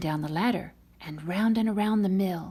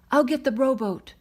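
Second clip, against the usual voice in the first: urgent yell, higher and louder; 7 semitones higher, 7 dB louder than usual. Acted. Father yells to his wife during the flood, urgent shout. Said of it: "yelled Nico".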